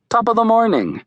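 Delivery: high, cheery mock-Irish greeting; stagey Irish brogue. A narrator's reading. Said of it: "wait, that's Irish".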